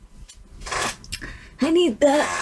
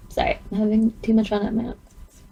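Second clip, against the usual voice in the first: ordinary delivery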